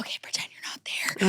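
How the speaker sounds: hushed, quick mock stage whisper; whispered aside. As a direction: whisper it fast, as if covering up. Mimics host covering awkward intro.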